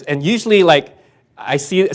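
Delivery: normal talking voice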